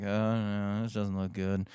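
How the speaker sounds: low, soft aside to himself; quietly uneasy, sinking in pitch; muttered misgiving. Retold or imitated. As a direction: say it under your breath. Muttered worry at first bad sign.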